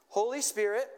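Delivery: slow, lifted prayer line for repeating; slow led prayer. Shown as a demonstration. Pastor leading the congregation in a slow call-and-repeat prayer. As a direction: pray it slowly so they can echo. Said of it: "I'll go slow".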